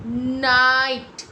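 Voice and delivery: नाइट very slow and loud, "naaait"; long I sound. Shown as a demonstration. Teacher showing silent GH, long loud नाइट. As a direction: stretch the vowel in नाइट, crisp final t. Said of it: "सिर्फ long sound होगा".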